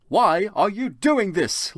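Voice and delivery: high, slow demanding voice; angry question. Acted. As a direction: demand it angrily. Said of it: "Lupin said angrily".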